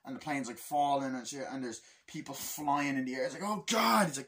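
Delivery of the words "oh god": high, loud cry of alarm; mock panic. Passenger as the plane falls, high mock panic.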